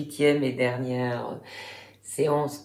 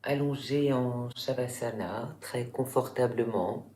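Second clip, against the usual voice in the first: guided relaxation; 3 semitones lower, 8 dB quieter than usual. Real, not acted. Yoga teacher guiding listeners lying in Shavasana, calm meditation voice. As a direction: guide them calmly through the body, softer and lower, unhurried.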